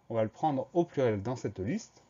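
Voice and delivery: ordinary talk, nothing marked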